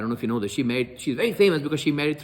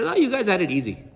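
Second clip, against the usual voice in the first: mild taunt, higher pitch; retold taunt; 6 semitones higher than usual. Umar teases the Habasha migrant, mild taunt. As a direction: needle them lightly with a raised "oh". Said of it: "he's taunting her".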